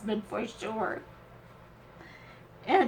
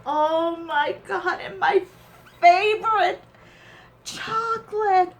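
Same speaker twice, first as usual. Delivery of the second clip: loud, high-pitched delighted outburst; overjoyed; 6 semitones higher, 9 dB louder than usual. Real, not acted. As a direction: exclaim in real delight at a gift. Delighted by favorite chocolate gift.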